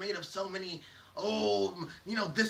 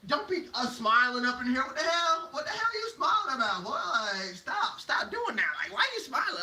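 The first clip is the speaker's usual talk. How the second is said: higher, incredulous nagging; mock scolding; 5 semitones higher, 4 dB louder than usual. Critics telling someone off for smiling, mock scolding. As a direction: pile up baffled complaints.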